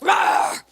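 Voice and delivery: loud breathy mock roar like a protective mother bear; mock mama bear roar. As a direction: roar it out like a mama bear guarding her cubs. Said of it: "you're like".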